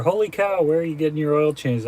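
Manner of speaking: surprised. Real, not acted. Reading a cheap oil change price.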